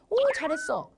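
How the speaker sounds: high-pitched praising parent; gushing praise. Acted. Parent praising a child who stopped crying, gushingly.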